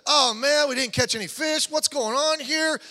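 whining. Retold or imitated. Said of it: "Peter could have whined and said".

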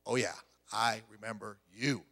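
low, knowing "Oh, yeah, I remember you". Retold or imitated. One of those ones you remember.

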